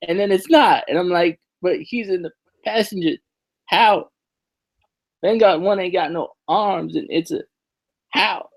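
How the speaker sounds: high-pitched retelling of her own confusion; confused disbelief. Retold or imitated. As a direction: act out being baffled, voice up high. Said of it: "'cause I was like".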